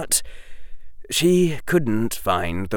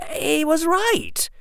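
sober. A narrator's reading. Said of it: "his face sober".

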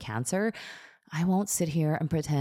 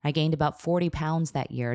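usual speaking voice

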